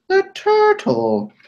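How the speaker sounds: high, slow squeaky repeat; high squeak. Pretend. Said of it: "repeats the turtle twice".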